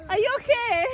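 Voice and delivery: mother calling out as her son falls in the snow; alarmed cry. Real, not acted. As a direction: cry out as he tumbles.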